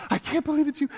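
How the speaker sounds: a touch of a weeping reunion; mild hammed-up tearful cry. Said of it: "she's bawling".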